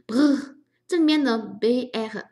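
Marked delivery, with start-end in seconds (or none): 0.1-0.5 loud trilled "brr"; a cold shiver voiced as brr. Shown as a demonstration. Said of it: "颤抖的时候用这个语气词哈".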